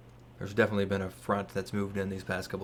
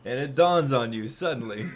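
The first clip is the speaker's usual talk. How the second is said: louder, laughing narration; 3 semitones higher, 7 dB louder than usual. Real, not acted. GM laughing at players.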